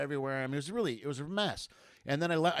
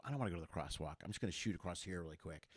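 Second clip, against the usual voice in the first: low quiet aside to yourself; muttering to self; 8 semitones lower, 12 dB quieter, faster than usual. Retold or imitated. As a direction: mutter the shortcut plan under your breath. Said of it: "and I go".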